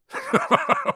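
high-pitched acted chuckle. A narrator's reading. Hagrid amused by Harry's doubt, chuckling. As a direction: chuckle heartily in character. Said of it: "Hagrid chuckled".

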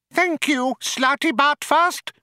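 high firm mouse voice. Acted. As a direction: shut him down firmly. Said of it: "said Benji firmly".

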